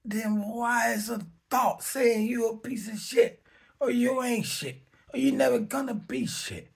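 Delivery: high, mocking voice of his own bad thoughts; mock inner voice. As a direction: mimic the nagging thought, high-pitched. Voicing the mind's insults.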